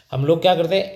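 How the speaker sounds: ordinary talk, nothing marked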